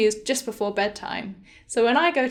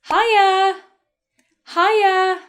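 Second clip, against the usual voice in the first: high, loud, friendly call; party greeting; 8 semitones higher, 7 dB louder than usual. Shown as a demonstration. Teacher modelling a very informal greeting, high and loud. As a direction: hail someone high and loud.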